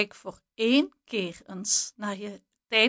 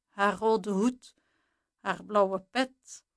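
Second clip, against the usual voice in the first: ordinary delivery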